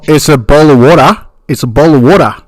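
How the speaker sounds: loud, hammed-up Aussie accent; mock Australian accent. Host imitates Australians saying bottle of water, in mock accent. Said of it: "in Australian".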